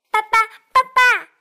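put-on high, loud child cry of papa; excited duckling. Duckling shouting papa at the fox, high and loud. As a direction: squeal it out with joy.

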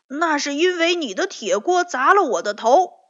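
loud, high, quarrelsome comeback; defiant retort. A narrator's reading. Tiaotiao won't back down in the quarrel, loudly defiant. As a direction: fire it back, refusing to give in. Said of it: "跳跳不甘示弱地说".

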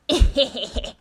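sneaky villain snicker; scheming heh-heh laugh; sly chuckle. Acted. Fox plotting.

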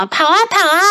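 high, cheeky taunting chant; gingerbread man voice. A narrator's reading. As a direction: taunt the chaser gleefully. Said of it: "姜饼人大笑地说".

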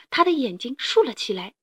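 ordinary delivery, nothing marked

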